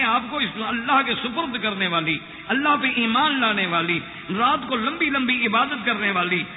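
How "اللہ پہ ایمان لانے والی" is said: usual speaking voice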